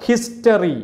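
ഹിസ്റ്ററി with a clear H at the start; sounded H. Shown as a demonstration. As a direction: let the H be heard in ഹിസ്റ്ററി. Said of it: "ഇങ്ങനെയൊക്കെ പറയുമ്പോൾ ഹ". (ഹിസ്റ്ററി, 'ഹ' ശബ്ദം കേൾക്കുന്ന വിധം)

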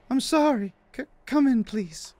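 high, timid falsetto, hesitant; apologetic woman. Acted. Nervous woman letting the visitor in, meek high falsetto. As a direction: play a meek lady in falsetto.

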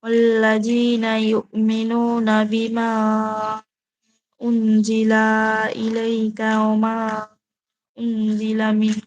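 very slow, drawn-out Arabic recitation; Quran recitation. Acted. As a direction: chant the Arabic words slowly. (ধীর আরবি তিলাওয়াত)

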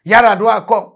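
ordinary talk

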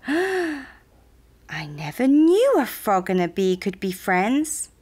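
slow, amazed storybook creature; astonished. Acted. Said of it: "All the creatures gasped".

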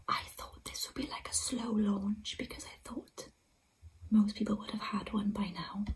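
thrilled breathless whisper. Real, not acted. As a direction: whisper the good news, stay hushed. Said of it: "I'm whispering 'cause".

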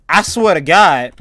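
spooked, drawn-out insistence; exasperated oath. Real, not acted. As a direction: punch it out slowly and loud. Spooked player repeats oath louder.

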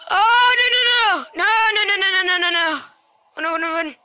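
crying out no, no, no; yelping in real panic; panicking gamer. Real, not acted. Caught by monster in game.

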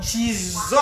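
ordinary delivery

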